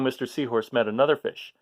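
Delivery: ordinary delivery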